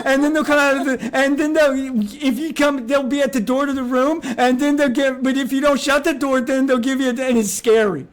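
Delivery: put-on high, fast, breathless kid gushing; excited child. Mocking the streamer as an excited child. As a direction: gush like an overexcited kid who can't finish a thought. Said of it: "like listening to a child who's really into a thing".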